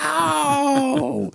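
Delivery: high, loud burst of wonder; amazed shout. Retold or imitated. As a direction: shout it like a delighted child. Kid tasting ice cream first time.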